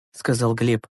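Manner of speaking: usual speaking voice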